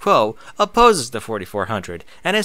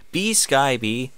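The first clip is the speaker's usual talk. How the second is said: normal talking voice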